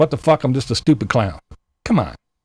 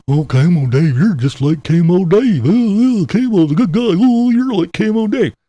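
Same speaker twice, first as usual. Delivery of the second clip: louder whiny mimicry of commenters; mocking commenters; 4 semitones higher, 5 dB louder than usual. Mimicking commenters who compare him to Camo Dave, loud whiny mock. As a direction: parrot the commenters, nagging and loud. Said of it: "you people come over here and say".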